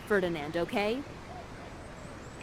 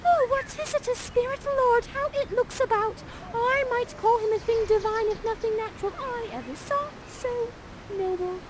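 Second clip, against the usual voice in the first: much higher, breathless awestruck maiden; swooning Miranda; 13 semitones higher than usual. Acted. Miranda spying on Ferdinand, much higher, awestruck. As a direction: gasp it out like a girl dazzled by a prince.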